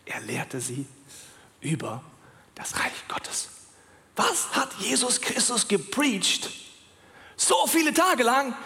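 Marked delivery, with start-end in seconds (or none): 0.1-3.5 much softer, whispered reveal; hushed, reverent whisper. Real, not acted. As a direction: whisper the key phrase for effect. Dramatic reveal in sermon.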